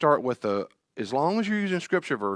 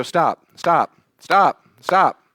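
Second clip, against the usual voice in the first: higher, louder commanding stop; a parent halting a spiraling child; firm stop; 7 semitones higher, 8 dB louder, much slower than usual. Retold or imitated. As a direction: cut the panic off hard. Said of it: "I go".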